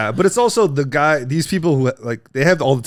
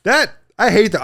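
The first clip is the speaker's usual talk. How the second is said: normal talking voice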